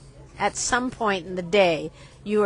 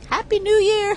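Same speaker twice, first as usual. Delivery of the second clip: excited; 12 semitones higher, 5 dB louder than usual. Real, not acted. Cheerful sign-off greeting.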